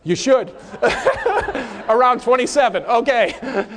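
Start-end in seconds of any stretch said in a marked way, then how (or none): 0.7-1.8 high, amused laugh. Real, not acted. Preacher amused by the class's answer, laughing high. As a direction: burst out laughing.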